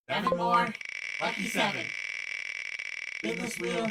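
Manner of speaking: high, loud spin-the-wheel announcer voice; game show announcer. Acted. Host spinning the wheel for his next caller, announcer voice.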